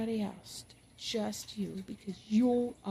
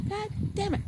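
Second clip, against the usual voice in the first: mock cursing at God; 11 semitones higher than usual. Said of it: "Tell him".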